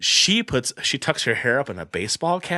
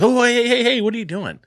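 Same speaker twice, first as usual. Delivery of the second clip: retold startled objection, pitched way up; alarmed protest; 13 semitones higher than usual. Comedian objecting as girlfriend pulls out lipstick, alarmed.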